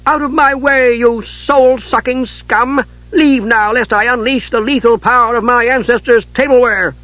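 brave, boastful challenge; defiant kender. A narrator's reading.

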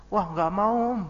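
mock child refusing politely; child's voice. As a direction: play the small child saying no. Child refusing uncle's money.

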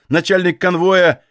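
ordinary delivery, nothing marked

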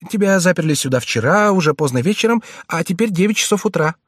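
put-on high, urgent pleading boy voice; frightened page boy. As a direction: plead anxiously like a scared young page. Clarence replies.

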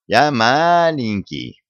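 slow, high little-bug voice; a small creature pleading it's too little to help; hammed-up tiny bug, drawn out. A narrator's reading. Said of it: "вильнул хвостиком жучок".

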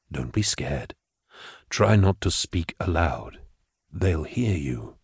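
low, soft telepathic calm; Gideon's psychic voice. Acted. Gideon reassuring Patience telepathically, low and soft. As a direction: speak gently into her mind, calming.

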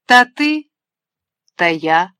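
scornful mimicry of the girl's boast; mocking retort. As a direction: sneer it back at her daughter. Mother mocks daughter in quarrel.

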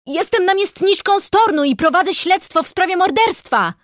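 high, loud shouted announcement; yelling. A narrator's reading. Investigator announces herself to the shooting forester, yelling. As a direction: yell your authority at the gunman. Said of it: "wrzasnęłam".

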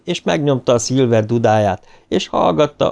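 plain reading voice

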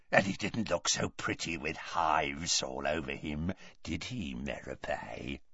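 low, soft, menacing gloat; gloating taunt. A narrator's reading. Morfin taunts his sister about Muggle, gloating. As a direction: sink to a hushed hiss by the name.

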